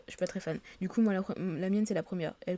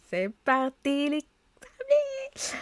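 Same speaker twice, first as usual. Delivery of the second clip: slow, high, stretched-out send-off; playful drawn-out cheer; 9 semitones higher, 6 dB louder, much slower than usual. Pretend. Player launching the adventure for viewers, playful drawn-out cheer.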